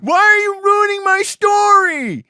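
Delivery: high, loud, drawn-out exasperated yell; outraged shout. Real, not acted. Game master to player ruining the story, outraged shout. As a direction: scream it in comic outrage. Player wrecks his scene, he yells.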